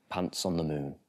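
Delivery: ordinary delivery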